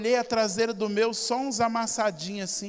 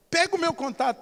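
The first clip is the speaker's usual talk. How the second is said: put-on insistent old lady; 7 semitones higher, faster than usual.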